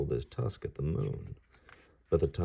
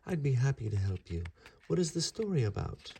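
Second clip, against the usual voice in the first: put-on higher keen kid voice; eager young god; 8 semitones higher than usual. Ganesha agreeing to help Vyasa, higher eager voice. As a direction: offer help brightly.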